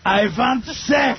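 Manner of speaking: mock German accent. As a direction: bark it like a stiff German. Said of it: "It's like they're spitting at you".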